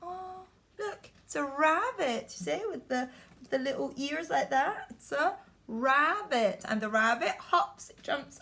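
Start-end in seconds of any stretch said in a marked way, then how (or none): none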